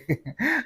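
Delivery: short high laugh. Real, not acted. Host reading comments bursts out laughing at them.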